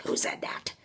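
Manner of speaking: urgent soft whisper. Retold or imitated. Teller asking friends who said the chant, whispering. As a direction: whisper the question sharply. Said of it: "And I'm, like, whispering".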